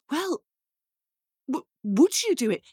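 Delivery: eager, swooping question; hopeful girl. Acted. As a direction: stammer with sudden hope. Girl pleads with the imp.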